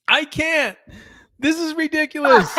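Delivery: high, loud laughing disbelief. Real, not acted. Laughing at clip.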